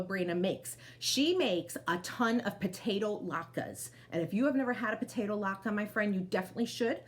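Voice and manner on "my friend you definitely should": ordinary delivery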